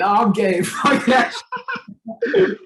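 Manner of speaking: high, loud laughing blurt. Real, not acted. Joking through laughter.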